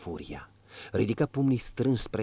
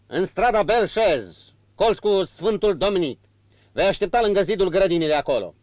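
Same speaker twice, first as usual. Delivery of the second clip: 1 curt instructions; 11 semitones higher, 9 dB louder than usual. A narrator's reading. Marquis instructs coachman.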